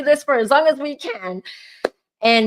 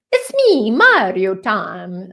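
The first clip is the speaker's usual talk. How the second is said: Mario impression; 6 dB louder, much slower than usual. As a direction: ham it up like Nintendo's Mario. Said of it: "I sound like a character of Mario".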